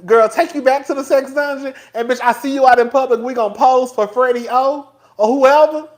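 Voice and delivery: normal talking voice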